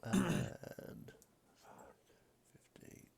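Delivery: whispering. Real, not acted. Whispering counts of movement.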